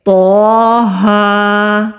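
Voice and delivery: تو ہا drawn out very slowly, تو said heavy; recited letters. Shown as a demonstration. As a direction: recite it long and heavy for students. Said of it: "ہونٹوں کو معمولی سی حرکت دیں گے". (تو ہا کو لمبا اور موٹا پڑھ کر دکھانا)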